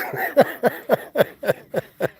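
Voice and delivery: high, airy giggle; high-pitched giggle. Real, not acted. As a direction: burst into a high little laugh. Laughing about dog hating water.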